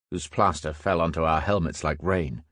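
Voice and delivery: ordinary delivery, nothing marked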